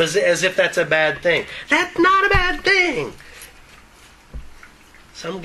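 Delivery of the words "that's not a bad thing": very high-pitched squeal of objection; exaggerated high-voiced protest; mock protest. Mock protest at verse's self-deprecation.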